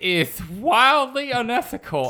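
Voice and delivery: lightly lisped pompous host voice; a faint lisp on every s, mock grave; mildly lisping news presenter; Murray's lisp. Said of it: "It's really hard to do a lisp for this long".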